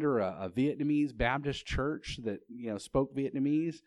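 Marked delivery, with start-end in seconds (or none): none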